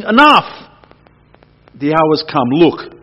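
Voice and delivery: Jesus rousing his sleeping disciples, loud and urgent; urgent call. Acted. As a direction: shake them awake with urgency.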